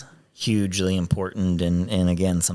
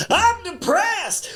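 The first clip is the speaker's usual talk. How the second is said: high, drawn-out hammed-up shout; mock joyful shout; much slower than usual. Mentor leaps up to a crowd with a hammed-up high shout. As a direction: belt it out way up high like a joke. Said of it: "jump up and say".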